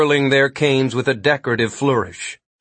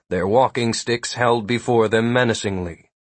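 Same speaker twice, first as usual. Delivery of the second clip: ordinary delivery, nothing marked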